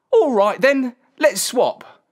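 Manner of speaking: put-on shepherd boy agreeing. A narrator's reading. Boy accepts the man's swap, high decided voice. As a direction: clinch the deal as a young lad.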